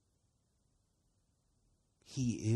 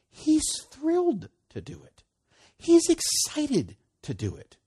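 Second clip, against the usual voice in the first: much higher, louder, thrilled; excited; 6 dB louder than usual. Real, not acted. Preacher to congregation, excited and much higher. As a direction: lift it up with joy. Preacher stresses God's eagerness.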